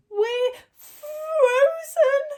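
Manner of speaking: very high, slow, tearful rabbit voice; shivering rabbit. A narrator's reading. Second rabbit at the door, very high slow tearful wail. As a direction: stretch it out in a cold little wail. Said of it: "said the other".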